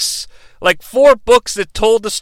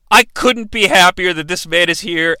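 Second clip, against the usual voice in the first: ordinary talk, nothing marked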